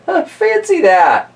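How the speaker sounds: put-on high, slow, amazed old lady; squeaky old lady. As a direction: marvel like a tiny old woman. Old lady marvels in story.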